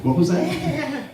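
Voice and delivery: slow, high, laughing disbelief. Real, not acted. Storyteller laughing at the memory of the call, high and slow. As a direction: laugh through the words, drawn out. Speaker laughs recalling the question.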